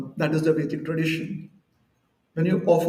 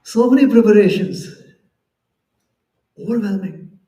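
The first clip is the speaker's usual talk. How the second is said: laughing wow, then much louder on so many preparations; amazed delight; 7 dB louder than usual. Retold or imitated. Devotee amazed by the festival feast, louder with delight. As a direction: marvel at the feast, bursting out.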